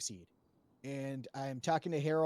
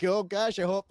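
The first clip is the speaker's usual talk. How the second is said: higher, louder mock fretting; mock panic; 3 semitones higher, 6 dB louder than usual. Retelling what Everett said about the call, mock panic. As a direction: imitate someone fretting in exaggerated alarm. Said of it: "me being like".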